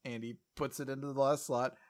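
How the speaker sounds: usual speaking voice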